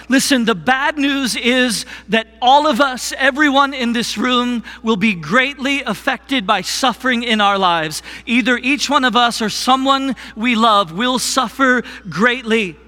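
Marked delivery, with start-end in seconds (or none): none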